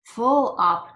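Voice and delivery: loud grunted words; a stuffed official's loud grunt. A narrator's reading. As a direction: grunt it out, full to bursting. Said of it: "grunted the undersecretary".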